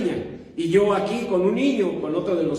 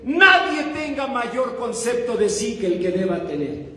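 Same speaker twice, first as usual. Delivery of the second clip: higher-pitched, bewildered quoting back; acted perplexity, voice raised; confused complaint; 6 semitones higher than usual. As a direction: protest to God as a puzzled believer. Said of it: "entonces ya no entendí".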